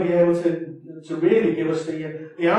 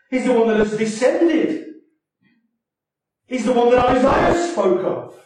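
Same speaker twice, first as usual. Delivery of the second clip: loud, higher-pitched preaching voice; forceful preaching; 5 semitones higher, 8 dB louder than usual. Real, not acted. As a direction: drive each declaration home from the pulpit.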